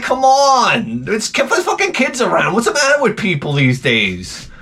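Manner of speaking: high-pitched, strained indignation; exasperated protest. Retold or imitated. Comic objecting to parade antics with kids around, exasperated.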